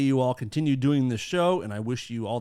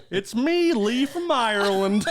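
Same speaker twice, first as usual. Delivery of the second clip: high, loud, drawn-out Irish caricature; mock Irish accent; 8 semitones higher, 5 dB louder, slower than usual. Host posing as listener Lee from Ireland, mock Irish accent. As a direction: stretch out a hammed-up Irish greeting. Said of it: "read this in the accent".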